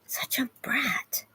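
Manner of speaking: slow, whispered mock complaint; whispered aside. Real, not acted. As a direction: breathe it out slowly as a whisper. Aside about the dog.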